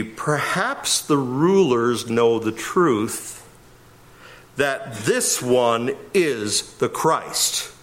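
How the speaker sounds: slow, deliberate literal read. Shown as a demonstration. Preacher reading the literal text to the congregation, slow and deliberate. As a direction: read it word by word, slowly. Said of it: "A literal read would be".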